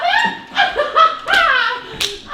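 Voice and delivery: drawn-out high mocking laugh; scornful laugh. Daughter laughing off her father's claim, scornful laugh.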